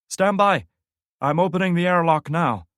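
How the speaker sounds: high, loud urgent orders; loud radio commander. Acted. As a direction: bark it through the radio.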